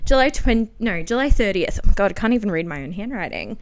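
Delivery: ordinary talk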